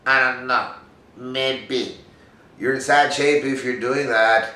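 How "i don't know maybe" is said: half-hearted, noncommittal shrug of a voice; mock reluctance, dodging the challenge; putzing out. Actor facing a cold read, putzing out. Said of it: "are you gonna putz out".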